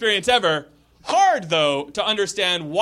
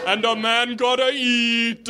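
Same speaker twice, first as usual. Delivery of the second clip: put-on raised, drawn-out Jamaican accent; Jamaican cab driver; 5 semitones higher, slower than usual. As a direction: reason aloud slowly in a Jamaican accent. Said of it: "my Jamaican accent".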